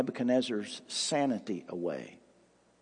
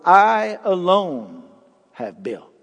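"I alone" slow and louder, with "have built" dropping away; emphatic I; 4 semitones higher, 7 dB louder, slower than usual. Shown as a demonstration. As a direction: hammer "I alone", then let "have built" fall. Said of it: "is in the emphatic".

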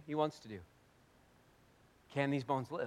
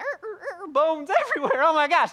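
high, loud laughing shock; much higher, louder half-laughing horror; horrified excitement; 9 dB louder than usual. Acted. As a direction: squeal at a horror-movie scene. Describes horror vision, laughs.

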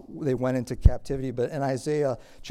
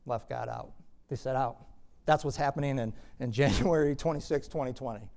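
ordinary talk

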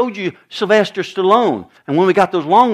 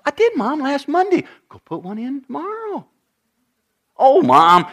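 put-on whiny teen; 7 semitones higher than usual. Teen son whining at mom.

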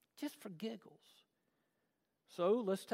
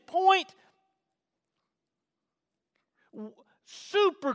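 loud, raised preaching with drawn-out key words; 8 semitones higher, 16 dB louder, slower than usual. Real, not acted. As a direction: drive each phrase home to the church. Preaches at full force.

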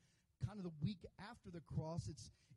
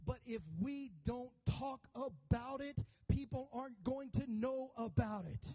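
higher and louder, rolling revival cadence; full-force preaching; 5 semitones higher, 5 dB louder than usual. Acted. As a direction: preach it to the rafters.

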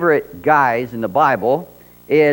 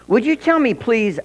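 Alice voice; 8 semitones higher than usual. Acted. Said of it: "Alice asked the cat".

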